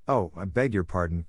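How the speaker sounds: ordinary delivery, nothing marked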